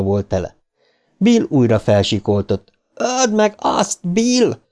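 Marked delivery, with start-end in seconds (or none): 2.9-4.6 very high, drawn-out call; slow high-pitched yell without much volume; urgent cry. A narrator's reading. Eddie urges Bill to kill it, high drawn-out cry. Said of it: "kiáltotta Eddie".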